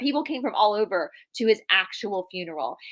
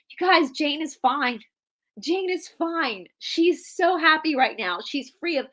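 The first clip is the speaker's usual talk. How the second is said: high, slow, beaming reassurance of the family; a grandfather glowing at a funeral, insisting all is well; lightly voiced old man, high and delighted; joyful reassurance; 6 semitones higher, slower than usual. Grandfather elated at sister's funeral.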